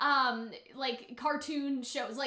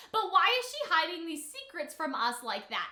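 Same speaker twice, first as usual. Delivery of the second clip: ordinary talk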